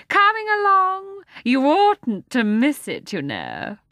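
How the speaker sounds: lion apologizes to Jane in a mincing voice. A narrator's reading. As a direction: play a dainty, apologetic lion. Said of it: "in rather a mincing voice".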